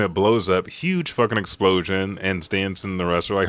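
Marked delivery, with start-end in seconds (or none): none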